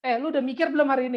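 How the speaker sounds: a playful, hammed-up parent; mock parent. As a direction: tease with a quick parent-style question. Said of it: "Kayak jarang orang Indonesia ya".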